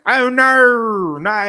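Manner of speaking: loud, high mock-Australian wail; hammed-up Australian protest; Australian accent. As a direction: put on a broad Aussie accent, voice pitched right up. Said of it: "that's my Australian accent".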